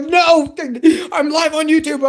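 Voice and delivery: high, loud laughing protest; panicked laughing refusal. Retold or imitated. Streamer refusing the wife on stream, high laughing protest. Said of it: "I'm like".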